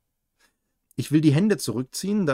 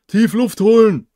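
call out; 7 semitones higher, 12 dB louder than usual. A narrator's reading. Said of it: "ruft eine dunkle Stimme".

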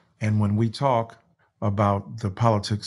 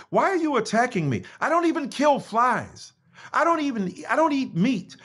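voicing a plea; 13 semitones higher than usual. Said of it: "Then you pleaded".

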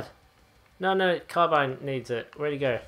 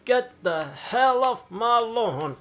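put-on slow cranky old man yell; shouting at trespasser; 5 semitones higher, slower than usual. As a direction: shout it like a grumpy grandpa.

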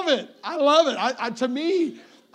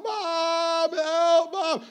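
put-on high-pitched little boy calling for his mom; child crying out; 7 semitones higher, much slower than usual. Little brother calling his mom for help, high and pleading. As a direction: cry out to Mom as a pinned-down kid. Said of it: "I remember crying out".

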